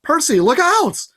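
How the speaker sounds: warning cry. A narrator's reading. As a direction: yell a warning across the bus. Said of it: "Annabeth cried".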